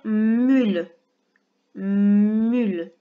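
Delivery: « Mule » bien détaché, un peu plus fort, voix qui descend; voix de dictée. Shown as a demonstration. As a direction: dicte « Mule » en appuyant sur chaque son. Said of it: "faire une petite dictée de syllabes". (« Mule » dicté lentement, en détachant chaque son)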